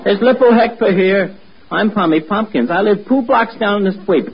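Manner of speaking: "Lippo" for Little, every T turned to a P; lisping T as P. Acted. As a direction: say "two" as "poo", puffing each T into a P. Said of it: "that's the way Tommy talks".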